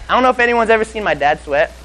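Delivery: ordinary talk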